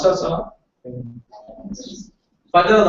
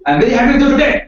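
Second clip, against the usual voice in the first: bright, happy delivery, a bit louder; 7 semitones higher, 11 dB louder, faster than usual. Shown as a demonstration. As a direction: say it as if truly pleased. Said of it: "how will I convey my happiness?".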